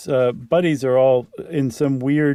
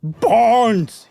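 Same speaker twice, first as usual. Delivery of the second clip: high, loud call down the stairs; 9 semitones higher, 6 dB louder than usual. Acted. Searching the dark house, calling for Barnes loudly. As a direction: call his friend into the dark. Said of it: "he called out".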